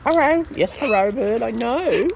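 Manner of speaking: high happy greeting; pet greeting. Real, not acted. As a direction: chirp hello to the bird. Greeting birds.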